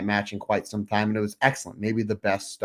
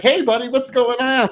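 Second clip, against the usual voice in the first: fake cheerful greeting; 12 semitones higher, 6 dB louder than usual. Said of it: "I'm like".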